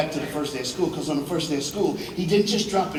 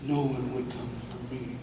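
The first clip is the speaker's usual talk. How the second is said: preacher confessing no one came for him; quieter echo; 5 semitones lower, 12 dB quieter than usual. Real, not acted. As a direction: let it fall to almost nothing. Repeated, much quieter.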